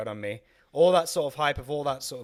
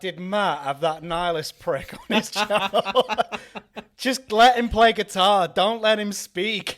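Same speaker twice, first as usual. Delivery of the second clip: loud, high, hammed-up complaint; raised-voice mock grumbling, breaking into laughter; mock outraged viewer; 8 semitones higher, 7 dB louder than usual. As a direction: play an indignant listener, voice raised. Acts out an outraged podcast viewer.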